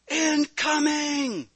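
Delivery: drawn-out high warning scream. A narrator's reading. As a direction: scream it as a warning across the field. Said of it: "Susan screamed as loud as she could".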